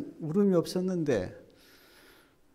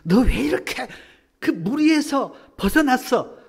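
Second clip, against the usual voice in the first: much higher, louder scolding rebuke; scolding Jesus; 8 semitones higher, 10 dB louder than usual. Acted. Jesus imagined rebuking the stray sheep, harsh scolding. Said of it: "그렇게 시각으로 보실 것 같아요?".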